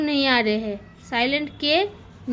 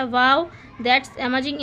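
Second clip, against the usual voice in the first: slow, careful teacher reading; slow example reading; much slower than usual. Shown as a demonstration. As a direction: spell the sentence out slowly for kids. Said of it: "teacher slowly reads example". (धीरे-धीरे उदाहरण पढ़ना)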